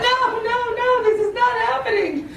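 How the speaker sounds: high-pitched, hammed-up denial; dismayed protest. Panicking as the rescue fails.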